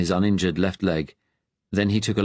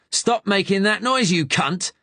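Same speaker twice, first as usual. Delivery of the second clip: furious Cockney; 11 semitones higher than usual. A narrator's reading. Gunman yelling at wounded Ben to stop groaning, furious Cockney. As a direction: snap it at the groaning man.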